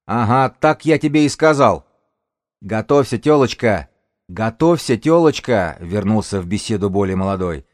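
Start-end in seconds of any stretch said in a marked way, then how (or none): 0.1-1.9 loud, high scoffing reply; scornful shout. Acted. Gang's elder refuses the woman, loud scornful shout. 2.6-5.8 loud repeated jeer; taunting shout. Acted. Young lookout goads the woman, loud taunting call.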